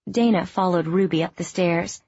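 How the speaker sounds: plain reading voice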